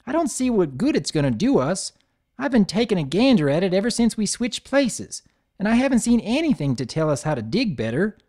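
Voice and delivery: put-on high, doubtful young filly; filly doubting the book; country filly voice. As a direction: grumble like a kid stuck with homework. Apple Bloom grumbles.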